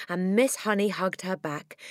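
usual speaking voice

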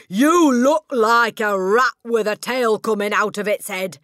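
loud, high jeering shout; mocking shout. Headmistress jeers loudly at the pupil's pigtails. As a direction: shout the insult with contempt. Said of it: "and shouted".

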